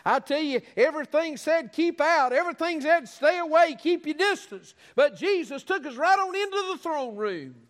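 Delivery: high, driving pulpit cry; impassioned preaching. Real, not acted. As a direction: preach it at full force, every phrase pitched high. Preaching at full force.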